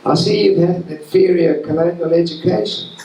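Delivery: put-on a friendly officer's New Zealand accent. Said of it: "I'll do my best New Zealand accent".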